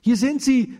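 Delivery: a high, loud call across the field. A narrator's reading. Lea calls Baruch to where the goats are, loud and high. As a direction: shout it to him from a distance. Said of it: "rief sie nach einer Weile laut".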